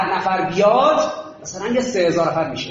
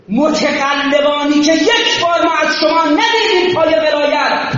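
shouted sermon, higher and louder throughout; fiery preaching; 8 semitones higher, 4 dB louder than usual. Acted. Preacher rousing the crowd to shield Islam, full-force shout. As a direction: hammer each line home like a preacher at full force.

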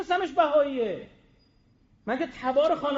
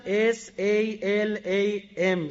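slow letter-by-letter spelling; spelled out; 2 semitones lower, much slower than usual. Shown as a demonstration. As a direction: spell S-A-L-A-M slowly, each letter apart. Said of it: "اس ام اس فینگلیش فرستادم". (هجی کردن آهسته حرف به حرف)

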